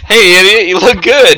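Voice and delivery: loud, high mock cheery holler; called-out greeting. Joking first-date compliment with an insult, hollered. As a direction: call it out big and high.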